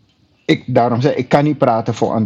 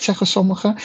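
ordinary talk

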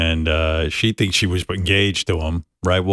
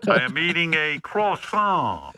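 much higher, hammed-up French accent; mock French lover; 9 semitones higher than usual. Host mocks the French suitor wooing Tan Mom, high hammed-up French accent. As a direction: play a cheesy French playboy in a silly high voice. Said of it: "Does he speak with a French accent?".

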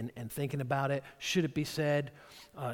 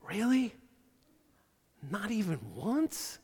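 sarcastic disbelief; 6 semitones higher than usual. Retold or imitated. Incredulous retort.

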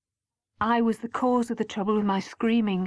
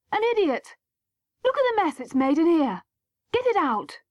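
upset mother scolding, pitched higher and pushed; angry shout, held back; 8 semitones higher than usual. A narrator's reading. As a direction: keep the anger going at the mess, without full volume. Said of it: "shouted Mum".

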